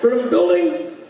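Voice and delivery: high, helpless monotone; drunk Rob. Retold or imitated. Lost friend answering where he is, high helpless drunk voice. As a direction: wail it like a clueless drunk. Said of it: "where are you?".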